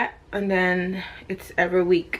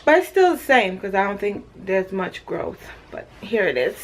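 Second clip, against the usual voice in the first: ordinary talk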